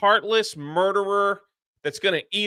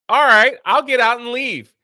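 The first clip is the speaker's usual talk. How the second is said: louder, higher mock huff; mock indignant; 7 semitones higher, 8 dB louder than usual. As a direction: throw back a hammed-up indignant retort. Acted breakup retort in a retelling.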